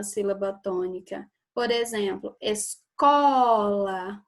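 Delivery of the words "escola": "escola" very slow, CO held long; stress on the middle syllable. Shown as a demonstration. Teacher showing a paroxytone, CO drawn out in "escola". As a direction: stretch and punch the "co" of "escola". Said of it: "o có é o mais forte".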